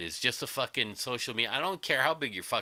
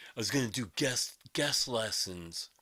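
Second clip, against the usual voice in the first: mocking whiny voice; 3 dB quieter than usual. Mocking the other guitarist.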